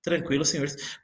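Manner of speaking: ordinary delivery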